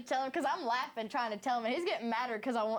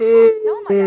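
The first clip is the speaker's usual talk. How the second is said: blurted out much louder and higher; loud exclamation; 9 semitones higher, 22 dB louder, slower than usual. Real, not acted. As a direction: burst out in shock. Exclaiming during awkward story.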